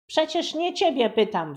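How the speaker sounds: high, loud annoyed yell; irritated girl yelling. A narrator's reading. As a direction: snap at your brother. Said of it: "wrzasnęła Buba".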